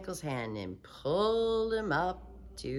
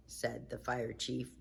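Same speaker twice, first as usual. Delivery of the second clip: plain reading voice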